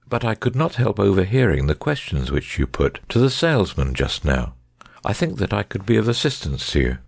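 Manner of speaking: lightly voiced detective, bland and polite. A narrator's reading. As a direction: say it with mild, unruffled courtesy. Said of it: "said Holmes blandly".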